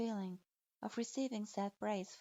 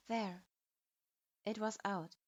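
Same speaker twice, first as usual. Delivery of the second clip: plain reading voice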